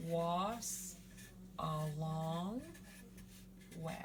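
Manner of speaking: "Was a long we" in separate pieces, long pauses between; sounded out. Shown as a demonstration. Teacher building the word for shining star, "Was a long we" sounded out. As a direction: break up "Was a long we", stretching "long". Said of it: "if you put the was sound at the beginning".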